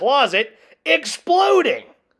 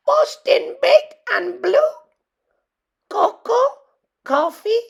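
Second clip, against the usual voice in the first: much higher, chirpy voice listing beans; high little dog voice; 13 semitones higher than usual. A narrator's reading. Poquito lists the beans to Skipito in a high chirpy voice. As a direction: rattle off the beans like a worried pup.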